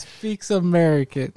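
slow, high teasing echo; mocking repeat. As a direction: drag it out high to mock him. Teasing friend's phrase.

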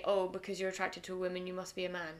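ordinary talk, nothing marked

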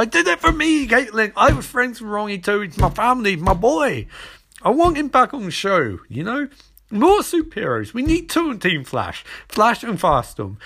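over-the-top, worked-up fan protest; impassioned outburst. Acted. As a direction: plead your case in a high, mock-desperate voice. Acted outburst of Cisco defending himself.

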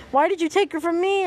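a restrained yell of protest; mild yell. A narrator's reading. Ash crying out to Arceus over his loss, mild yell. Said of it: "Ash yelled into the air".

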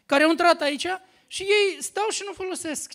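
loud emphatic preaching; preached emphasis. Acted. Preacher warning the congregation, loudly. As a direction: drive the point home to the hall.

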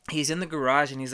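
ordinary talk, nothing marked

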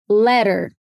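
careful "letter", t lightly tapped; flap T. Shown as a demonstration. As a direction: say "letter" American style, like "ledder". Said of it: "Vamos treinar mais algumas palavras".

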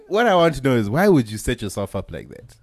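ordinary talk

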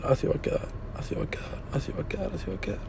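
soft unvoiced whisper, repeated quickly; whispering to himself. Real, not acted. To himself, imagining the same nice ending, a fast hushed whisper. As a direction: breathe it out like a private mantra.

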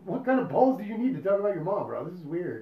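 high, loud incredulous quote; mock incredulous. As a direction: act out a baffled bro, loudly. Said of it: "you just look at him like".

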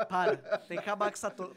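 usual speaking voice

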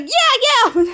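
high, eager cheer; acted cheering. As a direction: cheer someone on in imitation. Characters cheering on rescuer.

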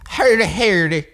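exaggerated Irish accent, high-pitched, hard R in Hardy; Irish accent with super-hard R; mock Irish accent. Shown as a demonstration. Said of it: "pronouncing your R super hard".

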